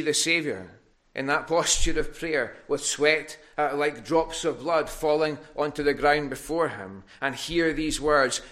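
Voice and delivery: impassioned preacher pressing the congregation; preaching at full force. Acted. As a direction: drive the questions home from the pulpit. Preaching climax on Gethsemane.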